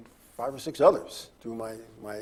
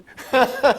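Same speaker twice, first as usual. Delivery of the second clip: a big high laugh, much louder; loud burst of laughter; 13 semitones higher, 10 dB louder than usual. Real, not acted. Host cracking up at his control room guy, loud laugh.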